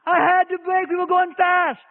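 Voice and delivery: mock upset wife; pleading wife.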